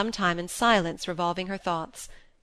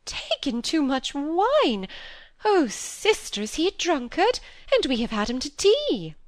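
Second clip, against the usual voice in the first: scandalised horror; 9 semitones higher, 3 dB louder than usual. A narrator's reading. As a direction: wail it like an appalled spinster. Phoebe horrified by drinking rumour.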